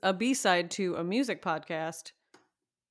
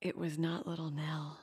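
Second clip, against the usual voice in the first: mock offended whisper; 5 semitones lower, 13 dB quieter than usual. Real, not acted. Playful indignant denial, hushed.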